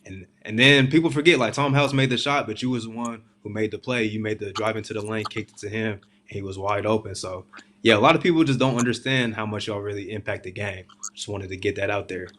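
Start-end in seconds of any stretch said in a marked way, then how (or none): none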